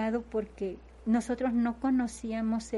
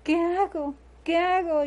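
retold panic, higher and louder; raised, urgent cry for help; desperate plea; 7 semitones higher, 6 dB louder than usual. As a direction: relive the helpless cry of a frightened mother. Retells her panic at doctor's warning.

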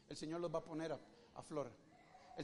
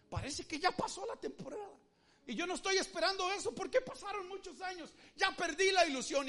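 despairing believer; 9 semitones higher, 5 dB louder than usual. Acted. Believer's lament voiced by preacher.

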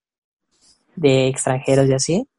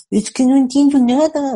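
a higher, put-on French accent on "no entiendo nada"; mock French accent; 9 semitones higher, 4 dB louder than usual. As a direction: imitate the French exchange student's odd accent, with a pout. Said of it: "su ademán en la boca ... su acento francés medio raro".

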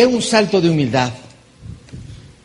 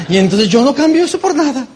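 stubborn mock refusal; 8 semitones higher than usual. Imitating someone resisting change.

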